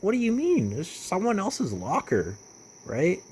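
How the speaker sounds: high, incredulous retold objection; mock disbelief, swooping high; baffled protest. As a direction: protest with the pitch leaping up. Retells his protest at being singled out.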